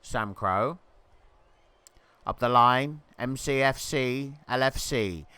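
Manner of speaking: normal talking voice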